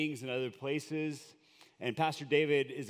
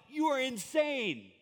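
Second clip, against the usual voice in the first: incredulous crowd; 11 semitones higher than usual. Retold or imitated. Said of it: "You would have thought".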